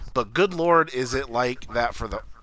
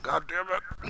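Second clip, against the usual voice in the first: fighting back tears; 5 semitones higher, 8 dB quieter, slower than usual. Retold or imitated. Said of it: "fighting back tears and shit".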